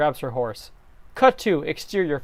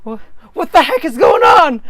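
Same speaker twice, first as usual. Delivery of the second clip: high, loud baffled yell; startled shout; 10 semitones higher, 6 dB louder than usual. Acted. Link shouting in alarm at the cheese hand.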